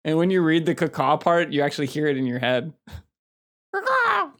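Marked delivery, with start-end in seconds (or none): 3.7-4.3 crow caw. Pretend. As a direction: shout it like a cawing crow. Imitating a crow call.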